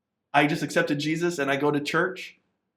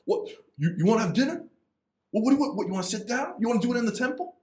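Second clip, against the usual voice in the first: higher, eager Italian dad voice; Italian dad accent; 10 semitones higher, faster than usual. Retold or imitated. Imitating an excited Italian dad who invites you to dinner, higher. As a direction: play an excited Italian father welcoming a guest. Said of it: "you definitely put on that Italian accent".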